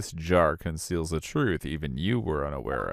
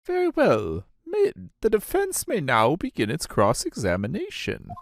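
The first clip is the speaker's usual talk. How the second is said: put-on high, fussy old judge; 11 semitones higher, 3 dB louder than usual. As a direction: play the doddering judge, pitched up. Judge voice again.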